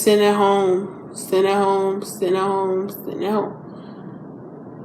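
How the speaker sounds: repeats it, fading to almost nothing; trailing off into a whisper; fading repetition. Real, not acted.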